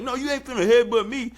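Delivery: high, defiant street voice; mock tough push back. Fighter snapping back at her rival, high and defiant. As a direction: clap back at her like Claressa. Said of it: "gives that push back".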